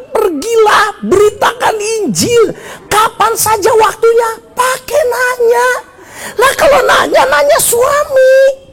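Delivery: very high, insistent exhortation; full-force preaching. Acted. Preacher insisting scripture already commands evangelism, high-pitched exasperated preaching. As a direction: climb high and press the point on them.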